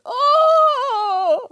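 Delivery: high loud wail. Acted. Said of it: "started wailing".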